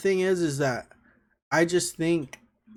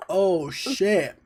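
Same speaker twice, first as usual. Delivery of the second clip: burned tongue; slower than usual. Real, not acted. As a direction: mouth on fire from hot candy. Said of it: "Dude, my whole fucking mouth".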